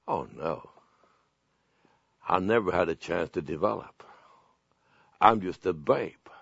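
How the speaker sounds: low, soft, deflated admission; dismayed regret. Acted. Believer looking back on no growth, quiet regret. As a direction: sink into quiet disappointment.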